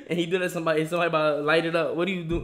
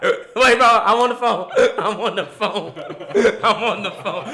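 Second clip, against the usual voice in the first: loud, higher, laughing through it; laughing mock panic; 5 semitones higher, 10 dB louder, slower than usual. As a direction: act scared while cracking up. Retold scared worker, laughing.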